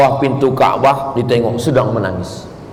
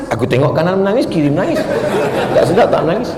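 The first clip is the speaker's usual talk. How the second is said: laughing reply; 3 semitones higher, faster than usual. Retold or imitated. Sheepish answer about crying.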